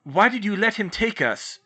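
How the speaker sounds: loud, accusing shout; angry shout. A narrator's reading. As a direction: shout the blame at her. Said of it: "George shouted at Hermione".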